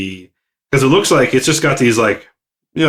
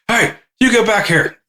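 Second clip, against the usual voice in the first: heated fight shouting; 11 semitones higher than usual. Retold or imitated. Scolding the fleeing robot.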